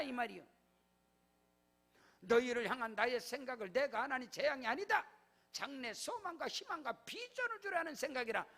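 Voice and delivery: loud, high pulpit proclamation; full-force preaching. Acted. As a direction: decree it over the congregation. Preaching blessing and decree. (강단에서 외치는 설교)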